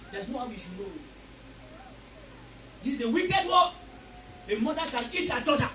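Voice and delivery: ordinary delivery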